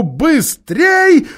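very high, loud urgent cry; shouted. A narrator's reading. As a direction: yell it to escape. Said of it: "Крикнув друг другу".

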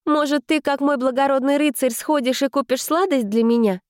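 put-on high sweet cutesy girl voice; breathy cutesy giggly. A narrator's reading. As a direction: coax him sweetly and cutely. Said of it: "милым голосом произнесла я".

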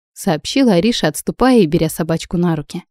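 ordinary delivery, nothing marked

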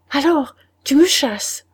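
loud, high wounded question; a stunned, hurt cry; hurt disbelief. A narrator's reading. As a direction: ask it as if struck. Laurence stung by dismissal.

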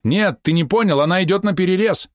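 high, alarmed exclamation; urgent warning. A narrator's reading. Helmsman warns merchant of boat cutting across, urgent. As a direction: insist urgently, pitched well up.

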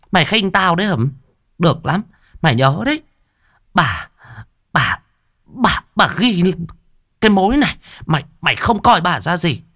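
high, shrill-pitched scolding shrew; scolding stepmother. Acted. Stepmother scolds the girl in a high furious voice. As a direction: berate her, stammering with rage. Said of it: "Quát mắng chưa đủ".

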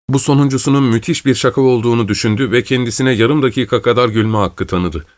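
usual speaking voice

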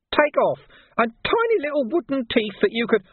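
a wheedling gutter lad taking offence; cheeky street urchin Nobby, aggrieved. Acted. Nobby's voice held.

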